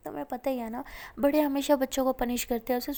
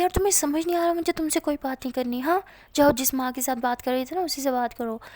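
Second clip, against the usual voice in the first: jealous outburst; 2 semitones higher, 9 dB louder than usual. Acted. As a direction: push her away in a jealous huff.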